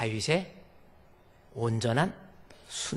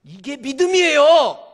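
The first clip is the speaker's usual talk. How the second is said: slow, loud and much higher; proclaiming; 10 semitones higher, 16 dB louder than usual. Acted. Preacher declaring faith to the congregation, loud. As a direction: ring it out as the punchline.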